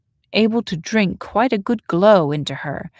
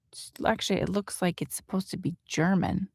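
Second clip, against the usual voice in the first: plain reading voice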